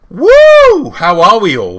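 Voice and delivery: high whoop into a loud greeting; loud hype. Real, not acted. Host welcomes viewers to the show, whooping and calling out loudly. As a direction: whoop and call out to the crowd.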